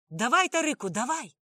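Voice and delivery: loud, high yelled command; urgent shout. A narrator's reading. Narrator shouts to send the boy running from the dogs, urgent shout. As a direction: shout it to urge someone on. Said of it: "крикнув я".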